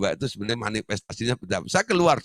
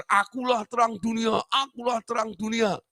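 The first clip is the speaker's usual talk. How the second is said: high, lightly shouted boast; patient boasting; 12 semitones higher than usual. Retold or imitated. The patient shows off to other patients, high boast. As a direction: show off like the patient. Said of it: "dia pamer".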